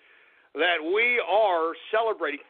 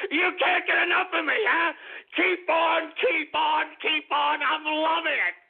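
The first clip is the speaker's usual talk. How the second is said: gleeful taunt; 7 semitones higher than usual. Real, not acted.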